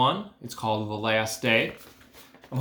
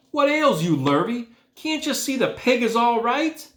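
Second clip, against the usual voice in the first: much higher, louder exasperated scold; angry scolding; 12 semitones higher, 5 dB louder than usual. A narrator's reading. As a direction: scold the farmhand. Drenched farmer scolds Lurvy.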